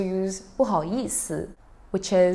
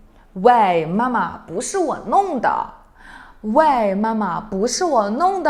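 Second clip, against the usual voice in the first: annoyed protest; 3 semitones higher, 7 dB louder than usual. Shown as a demonstration. Child protesting to mom it wasn't them, loud and annoyed. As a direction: push back on mom with loud irritation. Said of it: "As you can see from my intonation".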